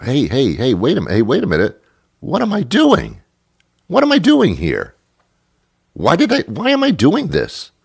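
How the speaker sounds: high-pitched mock alarm at your own actions; sudden startled self-questioning. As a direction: snap out of a daze and panic at yourself. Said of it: "and say to yourself".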